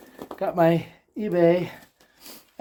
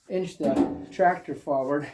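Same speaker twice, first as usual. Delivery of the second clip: ordinary talk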